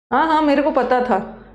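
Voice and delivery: ordinary delivery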